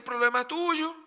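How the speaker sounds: loud, high-pitched imagined comeback; acted brush-off, pitched well up; dismissive retort. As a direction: throw the blame back, loudly. God's acted retort to complainers.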